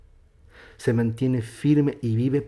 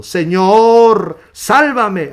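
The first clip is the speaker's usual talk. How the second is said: loud, high, drawn-out shout; full-voiced desperate call; crying out; 10 semitones higher, 13 dB louder, much slower than usual. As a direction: shout for rescue as if sinking. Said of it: "dio voces diciendo".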